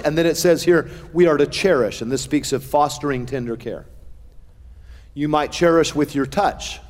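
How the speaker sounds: usual speaking voice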